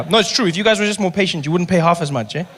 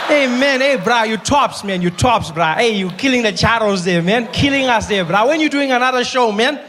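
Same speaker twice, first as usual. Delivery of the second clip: higher, hyped-up chummy bro; gushing, pumped buddy voice; frat bro; 4 semitones higher than usual. Retold or imitated. Second guy hyping.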